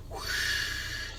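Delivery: long airy whoosh of driving rain; imitated rain whoosh. Showing viewers how the rain went, long whoosh. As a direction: whoosh like wind-blown rain.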